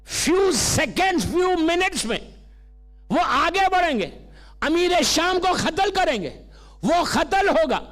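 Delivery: high, projected storytelling sermon; preaching. Acted. Preacher telling the congregation of Malik at Siffin, preaching. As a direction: build the drama for the crowd.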